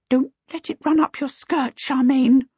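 high-pitched, fearful warning; fretful mother. A narrator's reading. Missus Baker warning Charmaine about the kobold, fretful. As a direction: warn your daughter anxiously.

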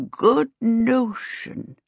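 put-on very slow, quavering old man; slow old servant. A narrator's reading. Sim slowly answering Charmaine, old and hesitant. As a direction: drag each word like a frail old man. Sim's voice in the story.

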